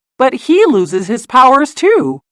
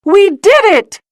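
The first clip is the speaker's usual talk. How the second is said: much higher, triumphant cheer; the whole gang cheers after pulling down the Zeppelin; cheering shout; 11 semitones higher, 3 dB louder than usual. A narrator's reading. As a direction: celebrate the win, high and bright. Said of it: "they shout".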